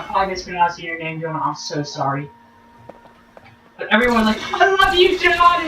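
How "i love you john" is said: high excited fan cheer; crowd cheer. Retold or imitated. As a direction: fans yelling to John Boyega, high excited cheer. Crowd cheering John Boyega.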